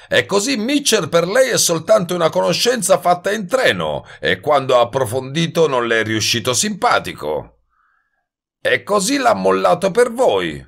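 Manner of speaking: high-pitched, sneering hustler; mocking wise guy. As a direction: taunt him in a high, cocky voice. Voices Goebel mocking the narrator. (sbruffone beffardo, voce acuta)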